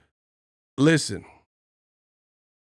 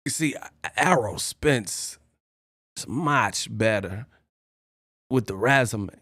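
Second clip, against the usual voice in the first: Christopher Walken; 4 semitones lower, 5 dB quieter than usual. Retold or imitated. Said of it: "how Christopher Walken say".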